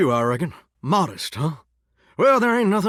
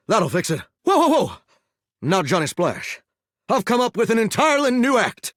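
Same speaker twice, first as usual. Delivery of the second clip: ordinary talk, nothing marked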